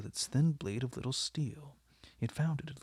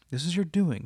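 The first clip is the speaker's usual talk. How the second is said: loud, higher bitter accusation; bitterly; 6 semitones higher, 7 dB louder than usual. A narrator's reading. Painter blaming Lord Henry for Dorian's outburst, bitterly. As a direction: blame him to his face. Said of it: "said the painter bitterly".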